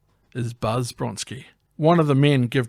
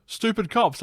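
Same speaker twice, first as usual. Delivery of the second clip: mocking; 8 semitones higher than usual. Real, not acted. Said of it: "I've put in here".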